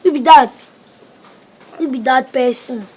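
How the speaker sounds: startled. Acted. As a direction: jump at the question. Boy alarmed.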